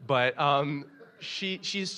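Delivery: ordinary talk, nothing marked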